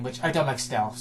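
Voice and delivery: usual speaking voice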